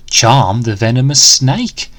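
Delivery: ordinary delivery, nothing marked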